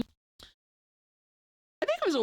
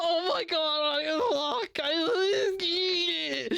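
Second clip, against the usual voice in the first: strained, higher cringe gasps; a touch of mock horror; mock mortified; 4 semitones higher, much slower than usual. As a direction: sound like you died inside. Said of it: "I was just like".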